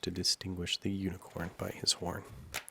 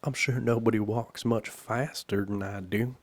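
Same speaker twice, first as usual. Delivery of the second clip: mildly sullen, louder protest; 3 semitones higher, 8 dB louder than usual. A narrator's reading. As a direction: sulk a little, defending yourself loudly. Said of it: "said in a sullen tone".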